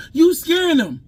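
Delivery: high-pitched imitation of an objecting mother; mock high-voiced complaint; protesting mom.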